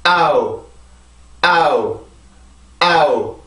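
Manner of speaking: 아우 chanted as one stressed beat; rhythm drill vowels. Shown as a demonstration. Teacher drilling the rhythm for students, steady chant. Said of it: "그 리듬을 먼저 잡아볼게요".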